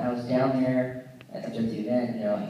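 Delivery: normal talking voice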